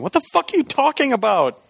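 high-pitched mock outraged yell; imitated yelling. Imitating listeners yelling at their speakers, high mock outrage. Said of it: "the people are yelling at their speakers".

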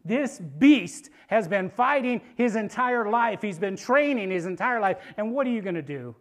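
loud, emphatic challenge; urgent warning. Acted. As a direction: warn the youngster forcefully. Saul warning youngster off the giant.

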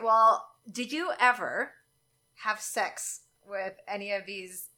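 normal talking voice

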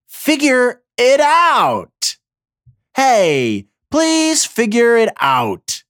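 slow, drawn-out exasperated plea. Real, not acted. Pleading with the officials to decide, slow and exasperated. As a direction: beg them to get it together.

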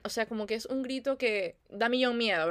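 ordinary talk

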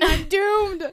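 high, loud mock wail; hammed-up doomed cry; mock despair. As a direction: wail it like a melodramatic teen. Retold despair over the girl.

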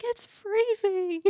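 very high, drawn-out complaint; mock cold whine. Quoting everyone else out in the wind, high mock whine. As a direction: slowly squeal it out, imitating shivery complainers.

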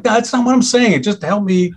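high, exasperated retelling of snapping at the waiter; frustrated. Retold or imitated. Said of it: "just frustrated".

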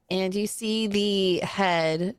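ordinary talk, nothing marked

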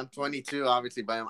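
normal talking voice

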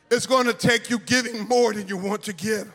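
ordinary delivery

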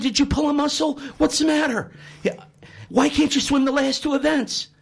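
a coach anxiously checking on his swimmer; worried coach. Acted. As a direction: coach fussing over his star swimmer.

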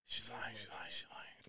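whispered, under the breath; retelling a cousin's news, whispering it's a lie; muttered denial. Retold or imitated. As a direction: mutter it to yourself in disbelief. Retold denial in shock.

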